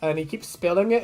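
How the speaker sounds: usual speaking voice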